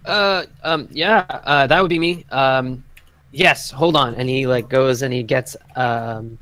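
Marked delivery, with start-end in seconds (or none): 0.0-4.1 flustered stablehand. Acted. Stablehand answers the party.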